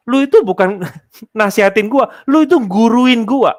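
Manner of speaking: mock offended coworker talking back; offended retort. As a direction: throw it back at someone lecturing you. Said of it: "membawa kita merasa gini".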